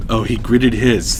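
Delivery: plain reading voice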